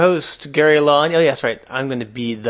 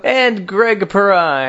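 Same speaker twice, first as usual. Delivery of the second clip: raised, drawn-out host introduction; show host announcement; 6 semitones higher, 5 dB louder, much slower than usual. Acted. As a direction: announce the line-up, stretching out the names.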